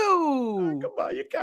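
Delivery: high, then dropped to a breathy Jersey mutter; a hint of a Jersey wiseguy; mobster voice. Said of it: "trying to do Paulie Walnuts".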